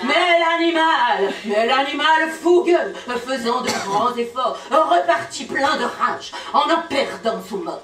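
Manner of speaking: emphatic dramatic verse; theatrical poetry delivery; poem recitation. Acted. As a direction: declaim it with rolling momentum.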